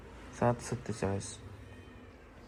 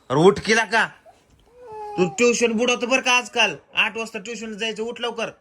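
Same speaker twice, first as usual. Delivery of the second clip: loud scolding; 6 semitones higher, 5 dB louder than usual. Real, not acted. Scolding son to get up.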